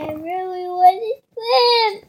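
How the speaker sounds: real whine, close to crying; whiny pleading. Real, not acted. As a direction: beg Mom, voice rising loudly. Child pleads with mom to swim.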